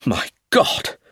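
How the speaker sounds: exclaim, aghast at the news; appalled gasp of words; shocked exclamation. A narrator's reading. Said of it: "exclaimed Roger".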